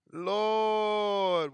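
usual speaking voice

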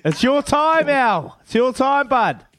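hyped up. Real, not acted. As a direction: fire up the caller. Host hyping up a caller.

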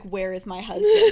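loud, laughing demand; hammed-up suspicious wife; mock accusation. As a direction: confront an impostor, half laughing. Wife confronts a suspected impostor.

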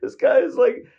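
can't believe what he's hearing; pitched-way-up indignant what; exasperated disbelief. Retold or imitated. Exasperated at the absurd claim.

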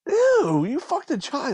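high-pitched grossed-out "ew"; half-laughing disgust at a friend. Real, not acted. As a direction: recoil in disgust, pitch jumping up. Disgusted at the lyric.

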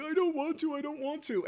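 put-on high-pitched little boy pleading; whining child. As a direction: imitate a kid who wants out. Voicing his son refusing.

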